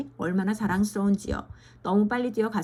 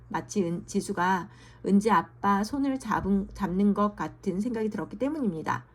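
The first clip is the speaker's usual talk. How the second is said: ordinary delivery, nothing marked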